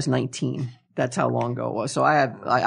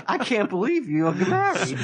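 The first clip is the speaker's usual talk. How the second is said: higher, slow mock disbelief; incredulous; 5 semitones higher, slower than usual. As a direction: imitate someone who cannot believe it, stretching it out.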